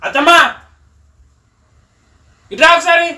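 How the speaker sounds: loud, high summons; calling out. Real, not acted. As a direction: shout for mother-in-law to come over. Calls mother-in-law over.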